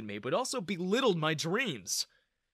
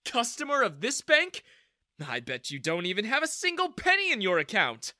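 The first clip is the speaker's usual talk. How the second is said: mocking; 8 semitones higher, 5 dB louder than usual. A narrator's reading. Manager mocking the customer.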